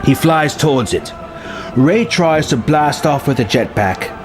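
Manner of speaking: plain reading voice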